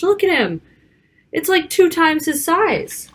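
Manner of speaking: amazed aside. Acted. Reader pointing out the picture to children, mildly amazed. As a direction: point it out with wide-eyed wonder.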